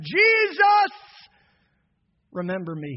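a loud cry, then a hushed plea. Acted. Dying thief calling out to Jesus, then pleading.

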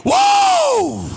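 huge high whoa, wrestler style; refreshed whoop. Shown as a demonstration. Sipping the drink, whooping like a pro wrestler. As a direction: let out a big wrestling whoop. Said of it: "and I do this".

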